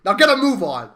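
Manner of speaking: loud, sharp command to hurry; barked order. Acted. Boss ordering staff to hurry, loud command. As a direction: snap the team into action.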